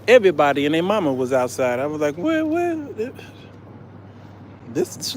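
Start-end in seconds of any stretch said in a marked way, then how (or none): 2.2-2.8 high startled where; ищет глазами, удивлённо; surprised. Retold or imitated. Looking around at the crowd.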